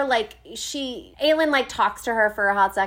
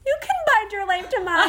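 high swooning; 9 semitones higher than usual. Real, not acted. Swooning reply to the character.

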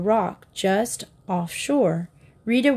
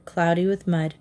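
usual speaking voice

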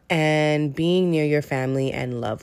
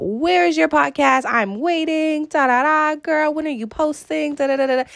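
high-pitched mock nagging from impatient listeners; impatient fans; 12 semitones higher than usual. Podcast host imitating listeners who texted her, high mock nagging. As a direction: play the fans pestering her for the episode, much higher.

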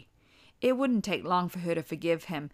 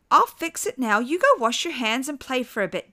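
motherly voice; 7 semitones higher, 7 dB louder than usual. A narrator's reading. Wife answers.

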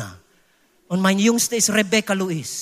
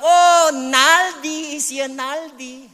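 drawn-out teasing "oh", higher and louder, then dropping away; slow, pitched-up playground taunt; mocking schoolmates; 4 semitones higher, 3 dB louder, slower than usual. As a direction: imitate classmates ridiculing a name. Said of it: "sie haben das lächerlich gemacht".

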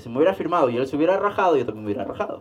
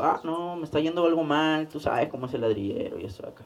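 responder bajito, quitándole importancia; excusa evasiva; 8 dB quieter than usual. Retold or imitated. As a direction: excusa a media voz, dejándola apagarse. (excusa evasiva en voz baja)

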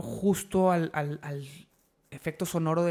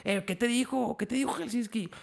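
higher, mock-paranoid prying; paranoid questioning; 5 semitones higher, 3 dB quieter than usual. Suspicious friend asking what Helsinki said, mock paranoid. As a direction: pitch it up and pester for an answer. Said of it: "Me puse todo paranoico".

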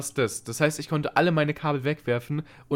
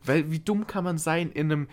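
ordinary talk

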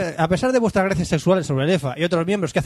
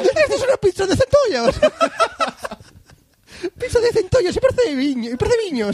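italiano agudo con toques gallegos; acento de Luigi. Pretend. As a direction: Luigi vendiendo pizza de centollos. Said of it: "acento de Luigi". (acento italiano-gallego agudo)

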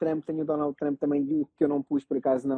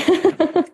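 laugh; 13 semitones higher, 5 dB louder than usual. Real, not acted. Laughing at shaving joke.

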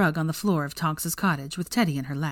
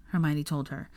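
plain reading voice

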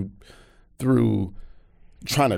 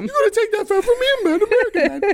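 put-on very high-pitched goading teen voice; imitated street boys; faster than usual. Teenage boys goading their friend about the American, high and mocking. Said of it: "their friends was like".

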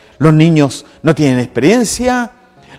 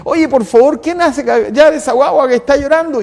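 higher, irritated hushing of the crowd; impatient disciples shooing kids; 6 semitones higher than usual. Retold or imitated. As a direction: shoo the children away like a fussy disciple. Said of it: "Y le dice".

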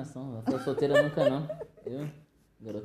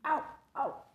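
dog barking. Pretend.

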